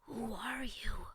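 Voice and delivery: slow, hushed whisper. A narrator's reading. Frightened boy questions the mirror, whispered. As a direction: whisper it warily, drawn out. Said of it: "then whispered".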